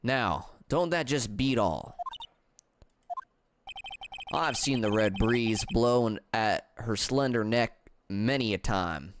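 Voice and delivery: deep-set Southern twang; Southern accent. Acted. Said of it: "stop me from doing this damn accent".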